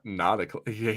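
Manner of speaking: ordinary talk, nothing marked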